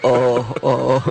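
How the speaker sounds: a light mock fretful "oh"; hint of the customer's whine; imitating his "oh". Mimicking the customer's fretting on the phone, lightly. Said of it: "how he talks, too, with that".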